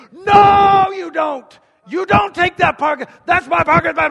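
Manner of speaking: high, very loud furious yelling; angry shout. Retold or imitated. Pastor acting out yelling over a parking space, furious shout. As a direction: claim the spot at full blast. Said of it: "he laid into that horn".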